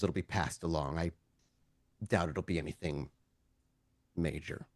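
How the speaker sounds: low, soft official voice; understated officer, low and matter-of-fact; low-key lawman, lenient. Acted. GM plays officer letting them go.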